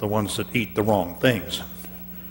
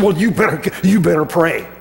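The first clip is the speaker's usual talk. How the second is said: insistent order; 6 semitones higher, 4 dB louder than usual. Acted. Captain insists again.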